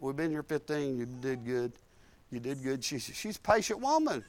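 usual speaking voice

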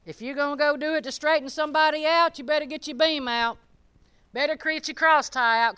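fired-up preaching. Acted. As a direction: drive it home to the congregation. Preaching at full force.

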